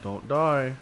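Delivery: ordinary talk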